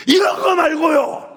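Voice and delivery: high, loud, slowed-down correction; hammed-up flustered woman; flustered shout. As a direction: blurt it out, embarrassed. Woman correcting her outburst.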